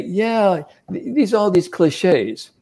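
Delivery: high, drawn-out imitation of doubters; mock dismissive. Coach imitates people brushing off golf cliches, mock dismissive.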